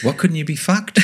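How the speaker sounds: high-pitched, puzzled question; baffled. Retold or imitated. Americans questioning Aussie slang, baffled. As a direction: mimic someone utterly lost by the phrase.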